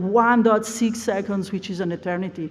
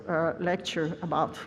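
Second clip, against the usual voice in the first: usual speaking voice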